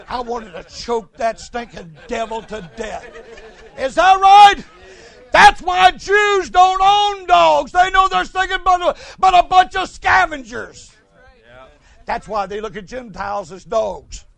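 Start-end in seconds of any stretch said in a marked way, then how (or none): 3.8-10.9 high, loud, slow shout; loud preaching. Acted. Preacher shouting to the congregation about dogs. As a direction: cry it out to the pews.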